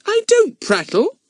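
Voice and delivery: put-on high, slow and indignant little girl. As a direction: huff it out, offended. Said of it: "said Betts indignantly".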